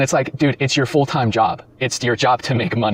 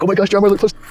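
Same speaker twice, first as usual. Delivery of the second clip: much higher, gushing fangirl squeal; higher giddy gushing over Drama Alert; mock giddy girl; 10 semitones higher, 5 dB louder, faster than usual. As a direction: imitate her giddy excitement. Mocking her giddiness.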